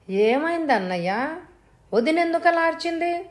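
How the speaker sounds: worried brother, pitch jumping on the second question; anxious and high-pitched; alarmed questioning. A narrator's reading. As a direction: ask urgently what has happened, voice climbing. Brother rushes in after scream.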